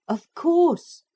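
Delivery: high, loud hearty agreement; cheerful all-together assent; chorus. Acted. Everybody agrees at once, loud and high.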